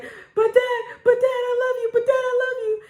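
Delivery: very high, whiny imitation of a pleading girl; high-pitched mock pleading with dad; pleading sister. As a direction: mimic a sensitive sister begging off punishment.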